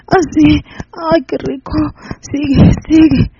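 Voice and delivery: high moans and cries of pleasure; moaning pleas. Acted. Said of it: "suplicando por más".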